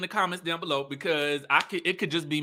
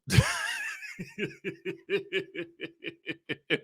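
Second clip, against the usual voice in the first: long, very high wheezing laugh; helpless high giggle; cracking up; 6 dB quieter than usual. Real, not acted. Cracking up at Kiki's reply.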